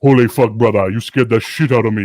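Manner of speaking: loud imitated Fijian accent; big, loud Fijian bouncer's exclamation; Rico. Fijian bouncer startled by his coworker, loud Fijian accent. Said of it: "Rico's like".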